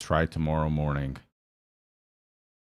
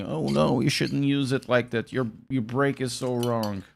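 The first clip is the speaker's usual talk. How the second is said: mock know-it-all; 7 semitones higher than usual. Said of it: "They can be saying".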